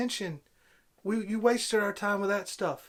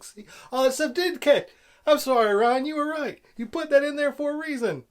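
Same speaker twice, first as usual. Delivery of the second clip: loud, much higher mock apology; sarcastic apology; 8 semitones higher, 7 dB louder than usual. Real, not acted. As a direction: pretend to grovel, loud and sarcastic.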